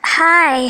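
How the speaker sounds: Hi with a long drawn-out I sound; pleased, stretched greeting. Shown as a demonstration. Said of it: "the I sound in hi is extended".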